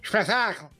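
booming TV host exclamation; game show host. Retold or imitated. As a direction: shout it like a quiz show host. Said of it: "Como é que diz o gajo lá do, do preço certo?". (apresentador de concurso)

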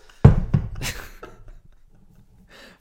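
bursting out laughing; loud laugh. Real, not acted. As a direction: laugh hard, high and loud. Laughing at own accent.

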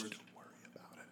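quick, barely-there whisper; mouthed words. Acted. Steven mouthing reassurance behind the others' backs, whispered. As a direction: mouth it almost silently, very fast. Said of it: "just mouth the words".

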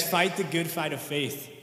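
ordinary talk, nothing marked

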